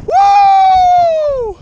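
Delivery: long, very high, loud whoop; victory whoop. Real, not acted. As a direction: let out a huge whoop. Celebrating the big catch.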